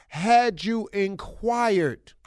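drawn out, each word landing; slow it down to make the point; slow pointed jab. Real, not acted.

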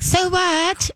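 squeaky unbothered dog; high mock dog voice. Trainer voicing the dog's reply, high and mocking. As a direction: shrug it off like a cheeky dog.

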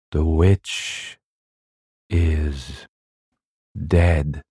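slow solemn intoning with long pauses; intoned. A narrator's reading. Husband opens the dark cellar game, intoning. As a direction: intone each word like a ritual, pausing between. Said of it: "intoned the husband".

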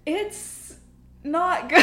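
high, breaking into laughter; laughing. Real, not acted. Laughing verdict.

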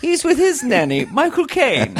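put-on very high, prim little British kid; British-accented child. Kid answering dad's call, high British accent. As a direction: pipe up as a tiny British child. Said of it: "The kid developed a British accent".

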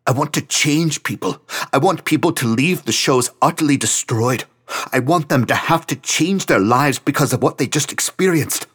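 high, loud, driven; high and loud frustrated manifesto; passionate rant. Acted. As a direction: pour it all out, demanding. Franz ranting about his band.

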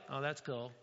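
soft, unimpressed mumble; bored "oh, that's cool". Retold or imitated. Congregation unmoved by heaven, soft bored mumble. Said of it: "And we're like".

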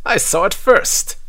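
high squabbling claim; grabby cry. A narrator's reading. Pothos claiming the key, crying out. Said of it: "cries Pothos".

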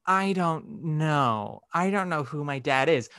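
slow, drawn-out "I don't know"; slow, weighty beat. Retold or imitated. Imitating most Sophies answering Harry about her dad, slow and weighty. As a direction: let each word hang, imitating an earnest actress. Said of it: "Most Sophies do, like, a beat".

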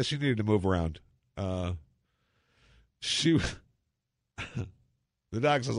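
usual speaking voice

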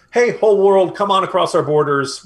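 loud, high mock open invitation; mock calling out. Biden imagined inviting the whole world in, mock calling out. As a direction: call out as if hailing a crowd.

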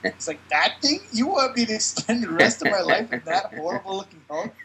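high indignant robot voice; hammed-up outraged robot. Robot voiced mocking the body.

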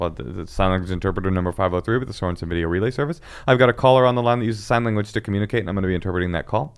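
fast, routine interpreter intro; rattled-off announcement. Shown as a demonstration. Interpreter announcing a relay call to police, quick and routine. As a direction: reel off the standard script quickly.

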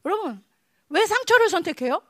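ordinary talk

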